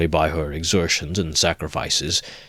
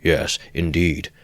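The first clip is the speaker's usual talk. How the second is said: usual speaking voice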